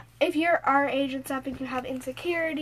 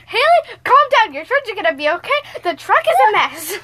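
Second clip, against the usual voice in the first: imitating friend; 7 semitones higher, 12 dB louder than usual. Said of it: "she was like".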